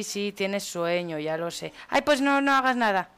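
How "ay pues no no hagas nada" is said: higher, louder exasperated outburst. Real, not acted. Player fed up with tired Martina, exasperated. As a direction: give up on them, loudly fed up.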